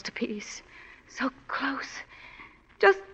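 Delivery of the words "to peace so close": breathy soft whisper; hushed whispered longing. Acted. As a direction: whisper it to yourself. Whispered longing near peace.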